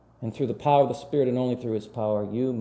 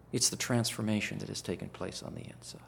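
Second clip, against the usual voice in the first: whisper; 2 semitones lower, 8 dB quieter than usual. Acted. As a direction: drop to an intimate whisper for the key line. Whispered sermon point.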